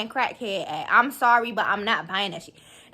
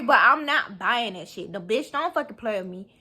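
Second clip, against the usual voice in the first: usual speaking voice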